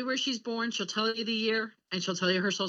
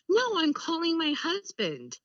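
higher, drawn-out mock insistence; retold indignant correction; 7 semitones higher, slower than usual. Mother correcting her aide about whom she called, high insistent imitation. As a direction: imitate a mother firmly setting someone straight.

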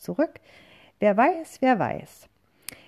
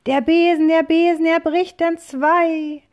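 very high, loud cry of alarm; panicked scream; 10 semitones higher, 8 dB louder than usual. A narrator's reading. Witch screaming as her broom snaps, very high and loud. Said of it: "Doch dann ein Schrei".

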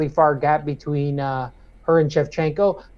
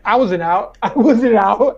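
dazed denial; 5 semitones higher, 5 dB louder than usual. Retold or imitated. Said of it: "was like".

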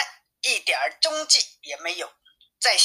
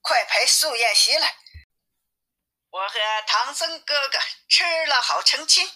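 a touch of the demoness calling out; higher, lightly voiced demoness giving orders; mild coy demon call; demon calling servants; 5 semitones higher than usual. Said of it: "他就喊道".

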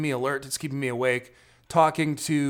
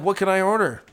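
retold exasperation; 5 semitones higher, 5 dB louder than usual. Retells exasperated reply at drive-thru.

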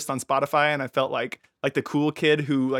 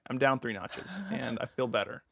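lower, softer, calmed-down voice; taken down three notches; 3 semitones lower, 8 dB quieter than usual. Pretend. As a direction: settle down after a mock rant, trailing softer. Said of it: "I'm gonna take it down three notches".

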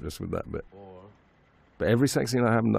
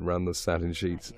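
ordinary delivery

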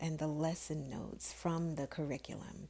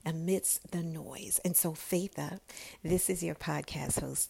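usual speaking voice

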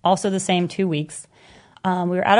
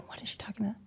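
whispered aside; 18 dB quieter, much faster than usual. Retold or imitated. Boyfriend mutters aside.

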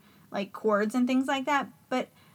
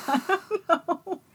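laughing; 2 semitones higher, 3 dB louder than usual. Real, not acted. Admitting to a co-host she can't explain it, laughing. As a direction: talk through real, cynical laughter. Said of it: "I'm laughing about this".

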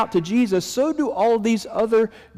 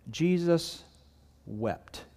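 hushed solemn; 7 dB quieter, much slower than usual. Acted. Shortest verse, dramatic pause.

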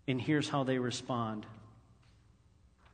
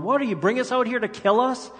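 high, loud accusing whine; mock indignant complaint; 9 semitones higher, 7 dB louder than usual. Israelites accusing Moses, high mock outrage. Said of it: "examples of them going".

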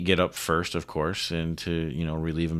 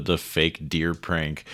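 ordinary talk

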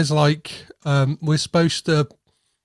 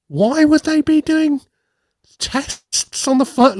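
high, incredulous questioning; mock disbelief; 12 semitones higher than usual. Podcaster questioning the film's phone excuse, mock disbelief. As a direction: pitch the baffled question way up.

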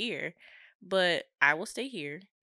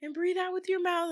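higher, coaxing instructor voice; mock instructor; 11 semitones higher than usual. Snorkel guides instructing a nervous swimmer, higher. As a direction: talk her through it like a guide. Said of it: "And they're like".

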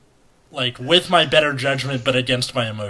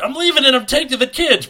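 mock fed-up spouse; 12 semitones higher, 4 dB louder, faster than usual. Jokes spouse quits over door sounds.

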